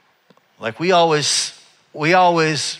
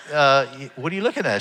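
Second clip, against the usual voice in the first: self-conscious. Retold or imitated. Client asking the staring stylist, self-conscious and hesitant. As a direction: ask it tentatively, stumbling at the start. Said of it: "I got a little bit self-conscious".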